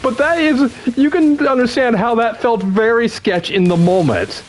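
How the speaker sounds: high-pitched, slowed by laughter; real laughter lifting the voice very high; laughing while talking. Real, not acted. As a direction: squeal it out through real laughter. Laughing through a sketchy story.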